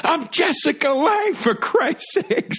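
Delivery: high, slow, indignant squeal; imagined outraged Jessica Lange. Retold or imitated. Jessica Lange's inner voice, outraged by the reporter. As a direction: shriek it in mock outrage at the insult. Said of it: "in her head, she's like".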